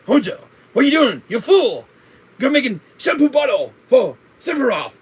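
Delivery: high, loud hammy mad scientist; Hojo voice. Acted. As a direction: shriek it like an unhinged old scientist. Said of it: "That's my horrible Hojo voice".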